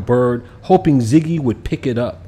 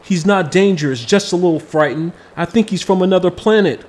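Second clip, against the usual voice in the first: sniffling; 6 semitones higher, 3 dB louder than usual. A narrator's reading. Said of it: "Kamal sniffled".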